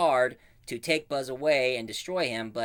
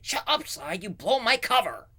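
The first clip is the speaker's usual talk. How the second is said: much higher urgent scold; hissing Buzz; 9 semitones higher than usual. Retold or imitated. Buzz scolding Slide for blowing his cover, high urgent. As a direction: shush him urgently. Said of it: "Buzz said".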